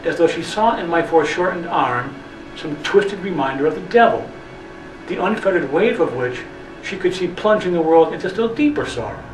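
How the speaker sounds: plain reading voice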